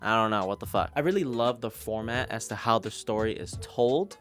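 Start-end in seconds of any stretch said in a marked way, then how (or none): none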